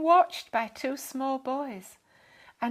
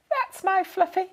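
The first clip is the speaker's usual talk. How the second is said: smiling; 9 semitones higher, 7 dB louder than usual. A narrator's reading. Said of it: "she smiled".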